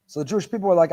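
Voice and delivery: ordinary talk, nothing marked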